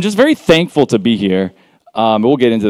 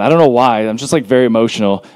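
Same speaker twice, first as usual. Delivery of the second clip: ordinary talk, nothing marked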